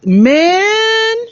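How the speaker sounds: high, loud outburst; exclaiming. Real, not acted. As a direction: cry it out. Moved by the reveal.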